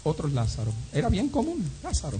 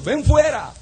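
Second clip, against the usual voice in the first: raised, high command; calling out; 9 semitones higher, 7 dB louder, slower than usual. Acted. Preacher imagines Jesus at the tomb commanding the dead, calling out. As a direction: call it out loudly as an order. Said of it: "y hubiera dicho".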